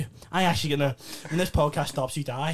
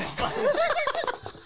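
squealing giggle; high laugh. Real, not acted. Reacting to prank.

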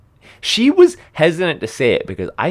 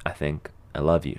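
softly, haltingly, a bit lower; hesitant, soft; 11 semitones lower, 10 dB quieter than usual. Retold or imitated. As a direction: test the words, unsure of them. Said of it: "She said it very hesitantly".

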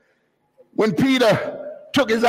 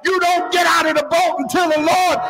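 raised, driving pulpit delivery; fervent preaching; 5 semitones higher than usual. Real, not acted. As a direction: drive the warning home to the congregation. Preacher at full force.

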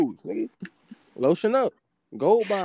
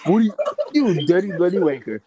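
put-on British accent; 3 semitones higher than usual. Mock British insult.

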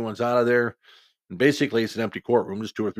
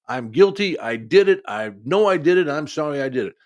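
louder, higher mock outburst; retold yelling confession; 5 semitones higher, 7 dB louder than usual. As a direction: blurt it out as the young girl, raised voice. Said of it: "They're both yelling at each other".

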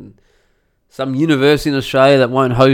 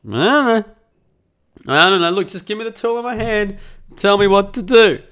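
baffled, higher-pitched mock pleading; exasperated cluelessness; 7 semitones higher, 5 dB louder than usual. Clueless handyman begging the neighbour for instructions, exasperated. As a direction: throw up your hands, loud and lost.

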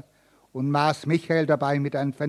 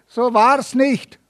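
higher, louder, slow anguished groan; 8 semitones higher, 7 dB louder, slower than usual. Acted. Accused Michael protests to the judge, groaning. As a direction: groan out the denial in despair. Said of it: "stöhnte Michael".

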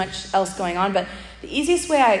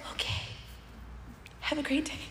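a tiny, much softer have a great day; awkward soft goodbye; 5 semitones higher, 15 dB quieter than usual. Retold or imitated. Awkwardly ending the talk with the crying lady, tiny soft goodbye. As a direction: mumble it quickly and escape.

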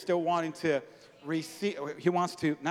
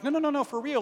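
high, louder insistence; insistent no; 11 semitones higher, 7 dB louder than usual. Retold or imitated. Pastor retelling insisting he pray. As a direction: push back high and eager. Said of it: "So I'm like".

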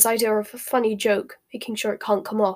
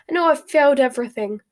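higher, louder despairing wail; hysterical; 4 semitones higher, 6 dB louder than usual. A narrator's reading. Hermione despairing over her exams, hysterical. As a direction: sure she has failed, half sobbing.

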